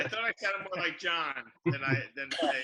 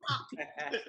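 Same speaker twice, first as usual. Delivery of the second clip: high dismayed curse; 13 semitones higher than usual. Retold or imitated. Hearing his own accent on tape, a high dismayed curse. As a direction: squeak it out in shocked dismay. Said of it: "I'm like".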